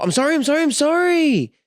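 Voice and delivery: very high, rattled-off mock pleading; kid's insincere apology. Parent imitating kids caught out, high-pitched repeated mock apology. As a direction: mimic a kid chanting sorry to escape trouble.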